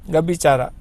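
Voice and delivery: ordinary talk, nothing marked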